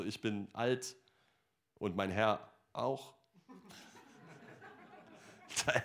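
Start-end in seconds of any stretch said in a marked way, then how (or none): 0.0-3.1 put-on lower, softer, slow doubting old woman; Sarah lightly voiced, low and resigned. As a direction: sigh it like a weary old wife. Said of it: "Sarah sagt so".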